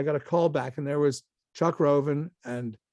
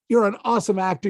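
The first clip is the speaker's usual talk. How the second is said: emphatic praise; 5 semitones higher, 5 dB louder, faster than usual. Retold or imitated. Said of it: "banged his hands on the table".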